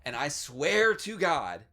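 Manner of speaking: ordinary talk, nothing marked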